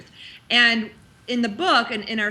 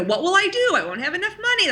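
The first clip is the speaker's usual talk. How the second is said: higher anxious worried voice; inner mean girl; 9 semitones higher, faster than usual. Retold or imitated. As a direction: fret like a panicky inner critic. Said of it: "the inner mean girl's like".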